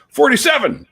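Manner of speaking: high, loud joke-number call; yelled out. Acted. Jailhouse inmate calls out a joke number, high acted yell. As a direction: holler it out to a crowded room. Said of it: "someone will yell out a number".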